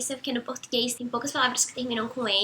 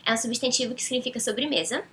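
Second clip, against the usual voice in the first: ordinary delivery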